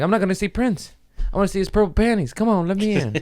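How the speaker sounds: a desperate fan begging to get in; high whiny plea. Retold or imitated. Begging to be let in to see [name], high and whiny. Whining about missing [name].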